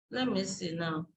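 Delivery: low, quiet self-talk while searching; muttering to self. Real, not acted. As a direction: talk softly to yourself as you hunt for something. Searching for an example, talking to self.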